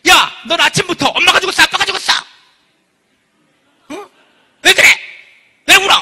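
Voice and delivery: hammed-up angry parent shouting down a crying kid; scolding parent. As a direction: yell it like a fed-up parent. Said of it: "찍소리 마형이 있어".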